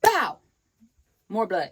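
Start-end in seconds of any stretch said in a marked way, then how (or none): 0.0-0.4 high, loud "bow" for a punch; imitated punch sound.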